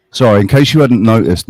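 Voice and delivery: ordinary talk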